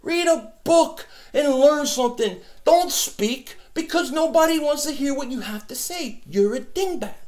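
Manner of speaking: high, sneering mock voice at the trolls; condescending high put-on voice. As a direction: lord it over the dingbats in a high sneer.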